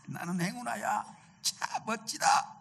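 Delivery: high, hushed exultation; acted elation. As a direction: marvel at your luck in a high, soft voice. Acts the lucky man's delight. (높고 작은 소리로 감탄하는 연기)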